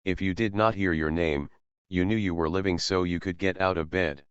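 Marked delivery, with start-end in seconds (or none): none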